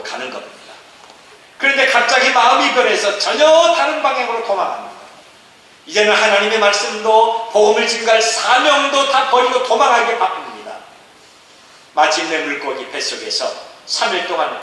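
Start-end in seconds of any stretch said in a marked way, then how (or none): 1.6-10.8 higher, driving sermon delivery; forceful preaching. Acted. (힘주어 외치는 설교)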